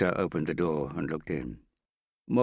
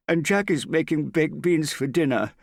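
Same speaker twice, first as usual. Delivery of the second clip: high, loud parting call; calling after someone; 12 semitones higher, 8 dB louder than usual. A narrator's reading. Prudence calls after Hester as she leaves, calling out. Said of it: "Prudence called after her".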